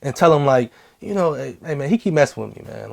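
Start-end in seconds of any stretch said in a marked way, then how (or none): 1.3-2.9 much softer, discreet complaint; low-key to the teacher. Retold or imitated. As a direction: tell the teacher quietly on the side. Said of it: "go to a teacher on a, on a slide".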